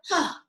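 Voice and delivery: sharp loud breath out huh; forceful exhale huh. Shown as a demonstration. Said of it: "as we exhale".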